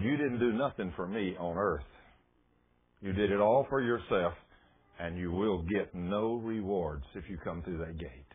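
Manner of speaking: understated booming voice of God; imitated booming voice. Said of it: "this booming voice came down over the wall".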